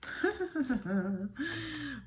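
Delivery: high, soft chuckling laugh; light drawn-out laughter. Real, not acted. Own laugh before reading the cards.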